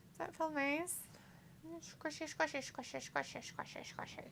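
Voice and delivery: very soft, high, near-whisper. Real, not acted. Asking the pet if that feels nice, soft baby talk. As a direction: murmur it high, fading into a playful whisper.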